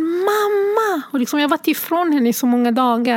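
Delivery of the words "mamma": put-on very high, excited little girl's call; child calling mamma. Mother imitating her daughter calling for her, high child voice. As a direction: cry out mamma like a happy five-year-old. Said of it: "säger hon".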